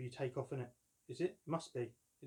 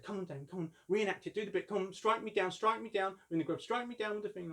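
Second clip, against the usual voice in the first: brother goading; 8 semitones higher, 4 dB louder than usual. Retold or imitated. Older brother urging reenactment.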